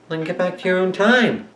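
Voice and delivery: bossy voice. Pretend. Skit voice ordering him away.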